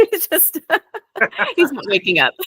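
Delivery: real laughter breaking through the words, pitched high; amused, high-pitched laughing speech. Real, not acted. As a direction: talk through helpless giggles, voice jumping up. Laughs at dog still snoring.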